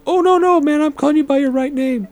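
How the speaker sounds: high-pitched panicky retreat, imitated; mock backpedaling. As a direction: play a heckler hastily backing down. Retold fan backing down from player.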